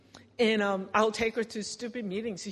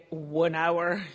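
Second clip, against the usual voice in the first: normal talking voice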